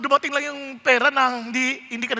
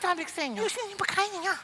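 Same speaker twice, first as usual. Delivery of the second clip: much softer, scolding under her breath; hushed scolding; 12 dB quieter, faster than usual. Retold or imitated. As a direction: scold the helpers quietly so the guest won't hear. Hostess hissing at helpers again. (pabulong na pagalit)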